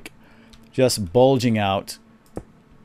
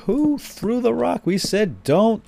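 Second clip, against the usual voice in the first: high, slow, stern reprimand; retold scolding; 12 semitones higher, much slower than usual. Dad confronting the kids about the rock: mock angry scolding. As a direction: demand to know who threw it. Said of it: "so I'm all freaking out".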